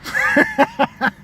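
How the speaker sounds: high-pitched burst of cackling; cackle. Real, not acted. Comic cracks up at own sick joke, high cackle.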